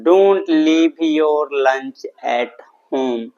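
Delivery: very slow, each word stretched out and separated; slow model sentence. Shown as a demonstration. English teacher giving students the answer, slowly and deliberately drawn out. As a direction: dictate it word by word for learners.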